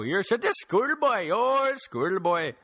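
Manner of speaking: high, slow Indian accent mimic; Indian accent impression. Mimicking his Indian friend refusing Spider-Man, in an Indian accent. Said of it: "he has that cliche accent".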